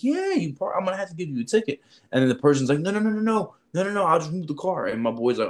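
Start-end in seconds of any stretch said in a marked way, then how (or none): 0.0-1.8 high, fast mock officer voice; mock parking cop. Recruiter pranks a driver, playing a high, fast parking cop. 2.8-4.9 high, pleading flurry of no's; hurried fake panic to dodge the ticket; mock panic. Driver begs the fake cop, high mock panic.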